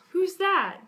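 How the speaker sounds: high, loud cartoon question; puzzled character. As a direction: pipe up as a baffled onlooker. Character asks about unknown doll.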